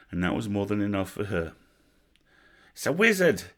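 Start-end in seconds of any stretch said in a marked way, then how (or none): 2.7-3.4 high, loud alarmed yell. A narrator's reading. Goblin sergeant spots an enemy wizard, yelling. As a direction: yell it out to rally the troops. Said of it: "somebody was yelling".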